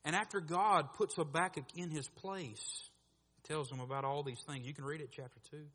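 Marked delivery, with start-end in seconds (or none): none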